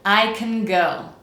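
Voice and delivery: slow, I and GO full, CAN as "kn"; reduced can. Shown as a demonstration. Teacher asks learners to repeat, reduced can. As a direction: stress I and GO, drop the vowel in CAN. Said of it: "Let's do it one more time".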